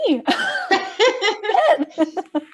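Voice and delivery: loud, high burst of laughter; cracking up after delivering the punchline; real laugh. Real, not acted. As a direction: laugh hard at your own comeback.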